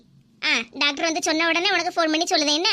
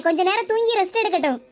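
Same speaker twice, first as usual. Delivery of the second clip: normal talking voice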